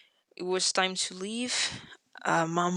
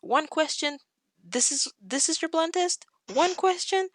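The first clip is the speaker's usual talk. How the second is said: incredulous repeated questions in a retelling; mock disbelief; 9 semitones higher than usual. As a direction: pitch climbing higher on each question. Retold disbelief at the test.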